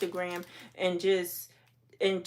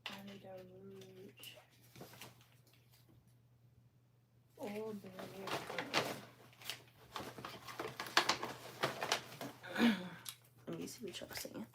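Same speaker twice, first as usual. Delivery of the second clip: muttering to herself; 12 dB quieter than usual. Real, not acted. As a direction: mumble it under your breath. Muttering to herself while sorting.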